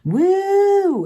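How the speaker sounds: high, loud mock party-girl cheer; imitating the annoying girls, a loud high whoop. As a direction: shriek it like an over-excited concertgoer. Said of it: "They're just like".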